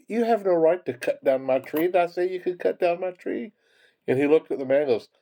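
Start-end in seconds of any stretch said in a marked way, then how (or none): 0.1-3.5 high-pitched mock scolding; indignant tree owner. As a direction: act out the outraged owner, pitched up.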